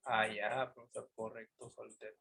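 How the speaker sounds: low, very soft acknowledgement; student's quiet reply. Pretend. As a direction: murmur it low, like a pupil catching on. Teacher voices an understanding student. (respuesta de alumno, baja y muy suave)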